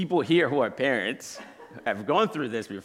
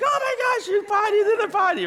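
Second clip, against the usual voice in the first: very high-pitched excited squeal; 4 dB louder than usual. Retold or imitated. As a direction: re-enact an overjoyed parent cheering a toddler. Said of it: "I'm so excited".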